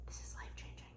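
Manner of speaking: sigh, then whisper it in bliss; a soft whispered rave about a treat; blissful whisper. Real, not acted. Blissful aside about peanut butter.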